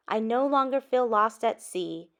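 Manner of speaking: plain reading voice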